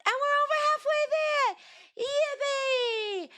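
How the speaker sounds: high, loud celebratory squeal; excited cheer. Real, not acted. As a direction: shoot way up in pitch and cheer. Celebrating progress toward a goal.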